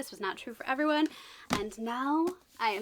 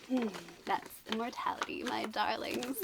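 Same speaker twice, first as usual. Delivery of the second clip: spooky host; 2 semitones lower, 5 dB quieter than usual. Acted. Spooky theatrical aside.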